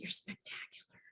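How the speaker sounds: tender retold whisper; soft hushed praise. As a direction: breathe it like a secret. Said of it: "I said".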